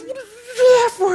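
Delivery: high, loud outraged shout; furious yell. Retold or imitated. Kid thrown off the bridge yells at his teacher, furious and loud. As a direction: yell at the one who threw you in. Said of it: "he's like furious".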